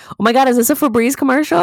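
high, fast, hammed-up excitement; mock excited outburst. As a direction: squeal it quickly like a delighted chatterbox captive. Acting out being blindfolded by kidnappers.

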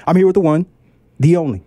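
big showman announcer intro; ringmaster. Acted. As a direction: ringmaster welcoming the crowd. Show intro in ringmaster style.

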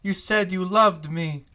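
high, loud lovesick wail; heartbroken Giuseppe. Acted. GM as Giuseppe calling after his fleeing lover, mock wail. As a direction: beg her to come back, hammed up. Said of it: "Giuseppe says".

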